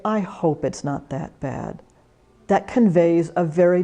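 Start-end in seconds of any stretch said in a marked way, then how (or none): 0.1-1.8 much softer, lower "I hope"; sincere hope. Shown as a demonstration. Volunteer answering a worried caller, soft and sincere. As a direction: quietly offer real hope. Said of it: "you can say".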